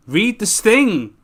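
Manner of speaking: high, loud and slow: Read this thing; mock-stern command, pitched high; emphatic order. Real, not acted. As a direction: drag out the order to the viewers. Warning viewers about spoilers.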